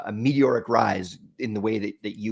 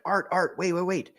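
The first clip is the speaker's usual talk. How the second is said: higher-pitched imitation of an urgent woman; lightly voiced lady trying to stop someone; lady calling out; 8 semitones higher, 3 dB quieter than usual. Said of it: "this lady's like".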